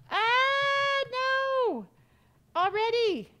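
high, loud shriek of real surprise; a sudden loud, high cry of dismay; startled scream. Real, not acted. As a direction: yelp as the monster grabs you. Caught by Granny in game.

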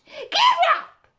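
shrill, full-force shouted order; shrill loud voice. A narrator's reading. Queen orders the gardeners up in a shrill shout. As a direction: shriek it as a loud command. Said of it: "said the Queen in a shrill, loud voice".

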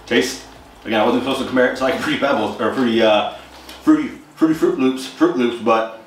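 talking between bites; chewing while talking. Real, not acted. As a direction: mouth partly full of cereal. Seen 0: Eats spoonful at -7, jaw working over bowl while talking.

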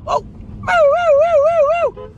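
very high, loud woe woe chant; excited whooping. Real, not acted. Vlogger spotting something, high loud excited whooping. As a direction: whoop with glee.

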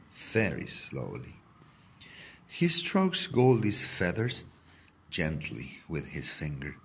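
usual speaking voice

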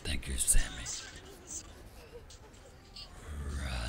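deep, eerie demon whisper; demonic voice. Acted. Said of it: "deep, eerie voice, that's me".

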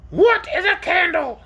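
high, slow creature voice; puzzled orc. Acted. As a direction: ask slowly as a high-voiced, curious orc. Puzzled orc asks in figure voice.

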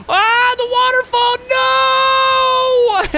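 very high, loud scream. Real, not acted. Falling down the waterfall, loud scream. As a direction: scream as you fall.

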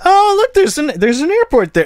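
high, loud feigned innocence; mock innocent surprise. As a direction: play dumb in a squeaky mock voice. Mock British innocence over airport.

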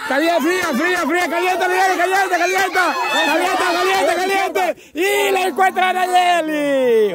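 high, urgent hot-and-cold calls; game host cheering the find; excited play-by-play. Real, not acted.

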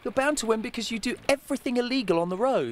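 put-on very high child voice, laughing through it; daughter's voice. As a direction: play the giggling little daughter. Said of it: "And she went".

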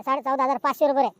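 ordinary delivery

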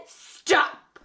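ordinary delivery, nothing marked

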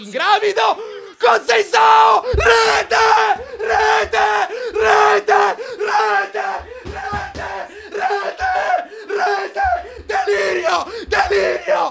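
screaming rete, high and loud; goal scream. Real, not acted. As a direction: go wild at the goal. Goal celebration.

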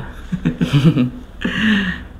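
short chuckle after a joke; amused chuckle. Real, not acted. Host teasing the young guest about his height, amused chuckle. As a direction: chuckle at your own remark.

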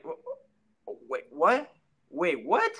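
halting stammer, leaping high on the second what; stunned speechless. Retold or imitated. Hearing his own fight announced, stunned. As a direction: replay stunned disbelief, stumbling into a high-pitched question. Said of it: "I couldn't even talk".

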